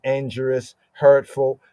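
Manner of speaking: usual speaking voice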